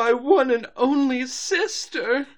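high, slow tragic wail; mock melodrama. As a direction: ham up the sisterly grief. Joins the Rarity wail.

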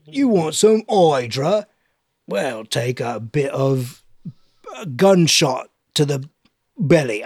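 slow, put-on menacing voice; hammed-up, deliberate threat; mock villain reply. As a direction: draw it out like a villain toying with a beggar. Villain answers the begging orphan.

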